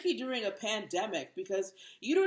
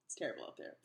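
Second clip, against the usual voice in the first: whispered aside; 12 semitones lower, 13 dB quieter, much faster than usual. Real, not acted. Aside after dating joke.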